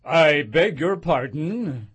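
low, slow, stiffly polite; politely offended; frowning but polite. A narrator's reading. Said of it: "frowning, but very politely".

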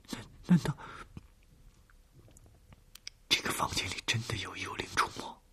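scared, whispered to herself; frightened whisper. Acted. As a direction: whisper it in fear, stammering. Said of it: "暗暗地问着自己".

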